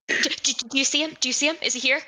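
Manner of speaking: fast, high frightened stammer; startled stammer. Acted. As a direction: blurt it out, startled and jumpy. Startled character yelps, whips around.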